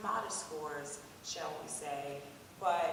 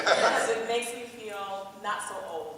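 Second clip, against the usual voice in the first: laughing through a joke about age; louder, amused and self-mocking; 8 dB louder than usual. Real, not acted. Admissions dean joking to the panel, laughing.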